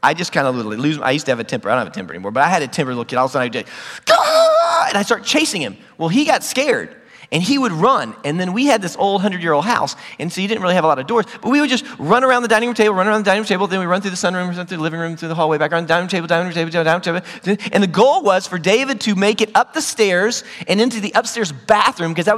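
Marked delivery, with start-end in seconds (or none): 4.0-4.9 long, high, loud mock roar; Hulk-mode temper yell. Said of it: "go into this Hulk mode". 11.5-17.7 fast, running-on repetition; breakneck listing. Retold or imitated. Mimicking endless chase round the house.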